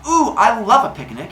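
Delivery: high, loud gush of mock delight; hammed-up eager girl voice; Mincinin. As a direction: squeal it like a thrilled girlfriend. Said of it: "And she goes".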